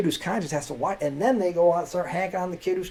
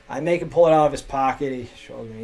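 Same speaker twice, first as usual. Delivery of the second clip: ordinary talk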